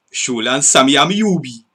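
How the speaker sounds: playful Geordie impression with a swooping Amiobi; Geordie accent. Shown as a demonstration. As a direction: say it like a Geordie mate. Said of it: "not want to do the accent".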